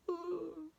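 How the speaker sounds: high, drawn-out moan; long pained ooh; tree's groan. Acted. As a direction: groan like an aching tree. The tree groans in pain.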